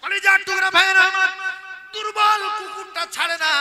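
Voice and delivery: high, loud chanted address; ringing preacher's call. Real, not acted. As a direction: call out to the brothers at full force. Preacher calls out to the congregation.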